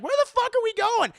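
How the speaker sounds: high, loud, hammed-up alarm; alarmed yell. Senator spots water below the helicopter, alarmed mock yell. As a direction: blurt it in a high panicked shout.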